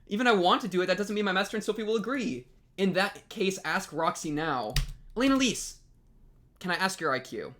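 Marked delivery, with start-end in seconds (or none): none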